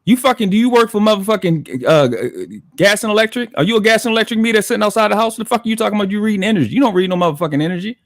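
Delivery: high, heated real rant; irritated. Real, not acted. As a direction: tear into them, exasperated. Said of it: "fucking irritated the shit out of me".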